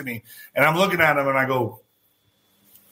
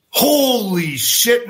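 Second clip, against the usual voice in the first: stunned realization; 7 semitones higher, much slower than usual. Retold or imitated. Realizes he is sitting with [name].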